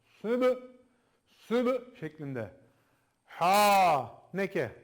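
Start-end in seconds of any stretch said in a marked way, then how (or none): 0.0-2.0 Sübü with the B burst out, raised and loud; popped B. Shown as a demonstration. Teacher demonstrating to students the popped B in Sübü. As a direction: pop the B in Sübü like a small explosion. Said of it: "patlatmak zorundasınız".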